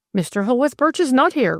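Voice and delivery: mildly taken aback; evident surprise. A narrator's reading. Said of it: "replied the vicar with evident surprise".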